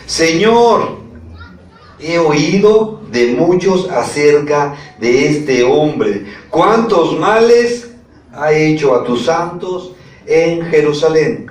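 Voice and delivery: slow, drawn-out reading with high peaks; dramatic scripture reading. A narrator's reading. Preacher reads Ananías's protest to the Lord, slow and emphatic. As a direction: spell out the objection phrase by phrase.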